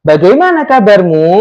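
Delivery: "Bagaimana kabarmu" lifted at the end; questioning rise. Shown as a demonstration. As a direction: let "kabarmu" climb like a question. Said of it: "maka nadanya nanti tinggi". (nada naik di ujung "kabarmu")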